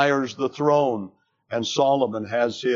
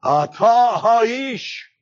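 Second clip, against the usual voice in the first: "Atah ha'ish" slow, loud and higher, each word hammered; emphatic accusation; 8 semitones higher, 5 dB louder, much slower than usual. Acted. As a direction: drag out "Atah" and drive it home. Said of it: "It's emphatic in the Hebrew".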